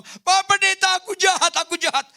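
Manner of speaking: high, loud wailing confession; wailing sobs. Retold or imitated. Sick husband wailing to the pastor, loud high sobbing. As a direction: cry it out in a high wail of remorse. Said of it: "nangis dia meraung-raung".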